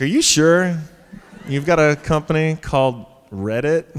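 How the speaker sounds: doubting his company even exists; incredulous. Retold or imitated. Serena doubting the app exists, incredulous. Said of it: "she's like".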